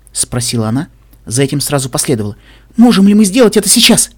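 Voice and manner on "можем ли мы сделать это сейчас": high, loud, urgent question; eager hope. A narrator's reading. Velvet begs to save her friend right away, with eager hope. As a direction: burst out with sudden hope. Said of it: "сияя страстной надеждой".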